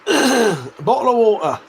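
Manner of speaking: slow English accent, "Bottle of water" with the Ts swallowed; slow English accent, "Bottle of water" with each T a glottal stop. Shown as a demonstration. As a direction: drag out "Bottle of water" the London way, no Ts. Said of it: "There's no T in any of that whole entire sentence".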